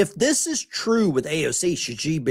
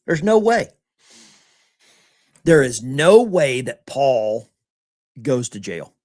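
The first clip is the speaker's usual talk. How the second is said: emphatic insistence; 5 dB louder than usual. Real, not acted. Streamer insists to chat Paul won't serve time, loud and emphatic. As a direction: pound it home, no way.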